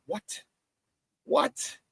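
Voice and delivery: high incredulous what; playfully shocked question; mock disbelief. Host teasing viewers, mock shocked.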